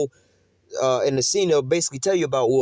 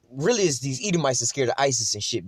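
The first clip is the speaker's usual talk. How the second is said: ordinary talk, nothing marked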